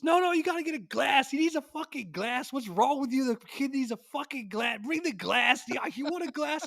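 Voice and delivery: high, loud brash uncle voice; a bossy old Italian uncle yelling orders; imitating Joe barking for a glass; loud New York uncle.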